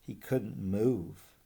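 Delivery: ordinary delivery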